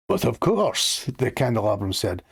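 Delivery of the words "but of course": high, hammed-up French accent; butchered French accent. The candelabrum agreeing to continue, in a butchered French accent. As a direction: put on a bad French accent. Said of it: "I'm gonna butcher this French accent".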